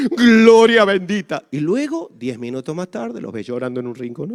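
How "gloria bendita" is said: mock pious praise.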